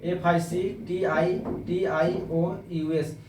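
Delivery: drawn-out letters for the class; slow spelling. Shown as a demonstration. Teacher slowly spelling fictitious aloud. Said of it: "spelling fictitious".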